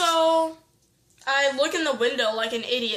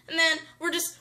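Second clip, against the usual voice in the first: ordinary talk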